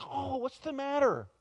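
high-pitched, startled 'what's wrong' in a retelling; acted concern. Voices concerned friend in story.

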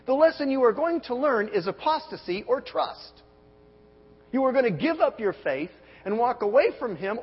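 high, loud pulpit build; fiery preacher voice; preaching at full force. Acted. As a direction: drive it home to the congregation.

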